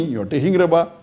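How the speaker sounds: ordinary talk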